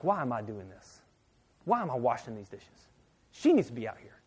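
low, muttered mock complaint; retold seething, kept low; mad inner grumbling. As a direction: grumble to yourself, understated resentment. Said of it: "I'm, I'm so mad".

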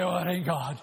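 low, mumbled brush-off; lightly voiced skeptic waving it away; mock dismissive churchgoer. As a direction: shrug it off like a doubter. Mocking a doubting churchgoer.

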